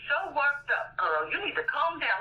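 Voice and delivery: ordinary talk, nothing marked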